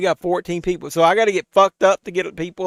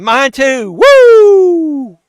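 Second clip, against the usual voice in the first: high whooping cheer, much louder; excited cheer; 11 semitones higher, 14 dB louder, much slower than usual. Real, not acted. Cheering for his small YouTube community, loud excited whoop. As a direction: whoop it up for the chat.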